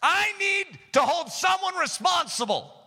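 high, emphatic demand; God demanding answers. Acted. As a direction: insist on it as the one in authority. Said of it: "God says".

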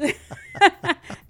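big burst of real laughter; loud laugh. Real, not acted. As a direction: laugh out loud, then fall away. Laughing at her own accent.

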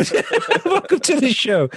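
laughing restart; breaking into giggles mid-welcome; nervous laugh. Real, not acted. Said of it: "you can hear that laugh there".

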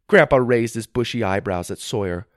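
ordinary delivery

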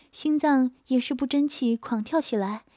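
ordinary delivery

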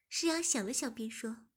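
ordinary delivery

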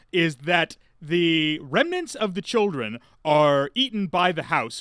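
normal talking voice

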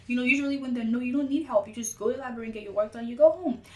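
ordinary talk

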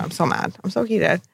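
a whispered real grudge; seething whisper. Real, not acted. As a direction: hiss it under your breath. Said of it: "How can you say mad for so long".

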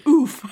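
loud, high comic "oof"; acted oof. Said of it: "and then goes".

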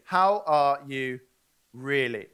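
really mean the question this time; gentle earnest how are you; sincere slow ask. Shown as a demonstration. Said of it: "contrasted with casual first take".

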